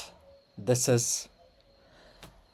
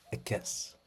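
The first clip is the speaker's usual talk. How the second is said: whispered kiss; 13 dB quieter than usual. Acted. Blowing a kiss to readers.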